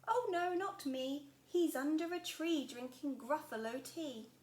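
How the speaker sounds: a fox cheerfully fibbing; sly fox. Acted. Fox answers.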